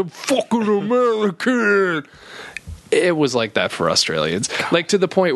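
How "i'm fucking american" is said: lightly done American bro voice; a hint of an entitled American tourist; mock entitled American, barely put on; impression of Americans. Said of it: "our impression of Americans".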